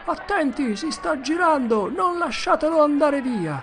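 high, loud excited shouting; crowd yelling. Acted. Crowd warning each other about Potter, shouting high. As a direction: cry out to stop him.

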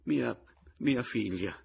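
nervous faltering answer; stammering. A narrator's reading. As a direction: falter and repeat timidly. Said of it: "balbettò Pomino".

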